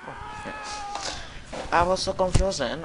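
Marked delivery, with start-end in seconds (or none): 0.1-1.8 very high squeaky oh yeah; squeaky exclamation. Real, not acted. Confused gamer reacting to a weird moment, high squeak. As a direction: pop up into a squeak.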